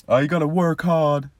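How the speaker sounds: high, loud mock American accent; trainer's pep talk, parodied in a high, loud American accent; imitating American accent. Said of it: "he spoke in an American accent".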